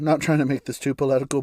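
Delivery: usual speaking voice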